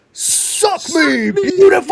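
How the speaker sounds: high, loud, triumphant yell; brash mock holler; cocky shout. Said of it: "just turns and just goes".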